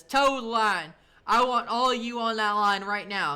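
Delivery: retold drill sergeant yelling at recruits; drill sergeant order. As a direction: bark it like a drill sergeant on day one.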